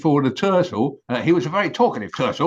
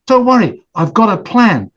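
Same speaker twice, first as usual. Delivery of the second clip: reassuring goose; 7 semitones higher, 7 dB louder than usual. Acted.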